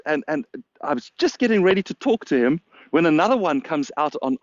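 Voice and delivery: normal talking voice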